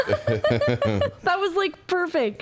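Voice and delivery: high squeal of laughter; laughing. Real, not acted. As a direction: laugh through the words. Laughing hard.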